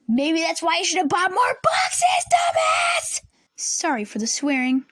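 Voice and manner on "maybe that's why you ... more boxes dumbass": angry shout. Retold or imitated. Student imagines yelling at teacher, angry shout. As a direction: let the rage burst out on dumbass. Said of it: "I was feeling some really pure rage".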